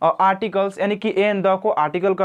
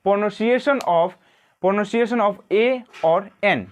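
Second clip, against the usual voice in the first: each word drawn out for note-taking; slow classroom dictation; much slower than usual. Shown as a demonstration. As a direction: read it slowly so the class can write. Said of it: "उच्चारण कैसे किया जाता है".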